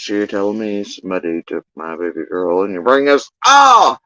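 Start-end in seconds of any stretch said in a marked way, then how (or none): 0.0-2.6 soft, low monotone impression; imitating a man's deep, toneless voice. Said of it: "dude with the most monotone voice". 3.4-4.0 high, loud "ah" of realization; acted jolt of recognition; sudden realization.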